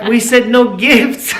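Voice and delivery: slow, laughing through the words; laughing protest. Retold or imitated. Husband laughing to wife about the no-gifts rule. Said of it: "I'm like".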